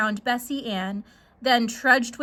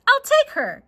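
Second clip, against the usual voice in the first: eager stranger; 13 semitones higher, 6 dB louder than usual. Acted.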